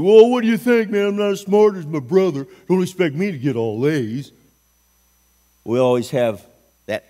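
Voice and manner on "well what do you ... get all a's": higher, louder, mouthy backtalk to a parent; whiny, defiant kid talking back; sassy attitude. Retold or imitated. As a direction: act out a kid sulking back at mom and dad. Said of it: "Instead of having an attitude".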